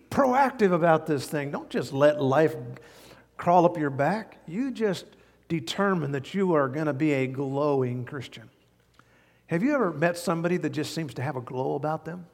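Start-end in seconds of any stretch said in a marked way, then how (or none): none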